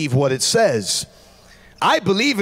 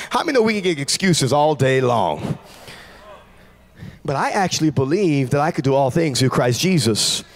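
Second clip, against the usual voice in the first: ordinary delivery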